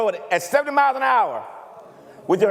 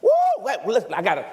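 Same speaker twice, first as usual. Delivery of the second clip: ordinary talk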